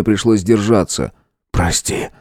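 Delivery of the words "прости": soft unvoiced whisper. A narrator's reading. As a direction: breathe the apology. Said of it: "прошептал я".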